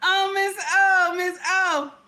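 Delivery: high, loud, drawn-out mock condolence; hammed-up, slow wail of sympathy. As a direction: exaggerate your own earlier concern. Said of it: "I'm in here like".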